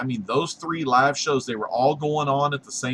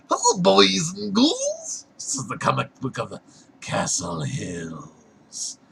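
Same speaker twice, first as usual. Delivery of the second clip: spooky host voice; 7 semitones higher, slower than usual. Acted.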